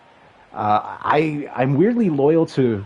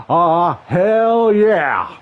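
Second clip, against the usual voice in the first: loose Texan accent, a hammed-up Stone Cold growl of hell yeah; mock Texan wrestler cheering hell yeah; Stone Cold impression; 3 semitones higher, 4 dB louder, much slower than usual. Streamer cheering a first down in a weak Texan accent. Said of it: "I cannot do that accent".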